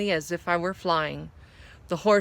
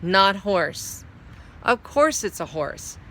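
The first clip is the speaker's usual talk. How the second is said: ordinary delivery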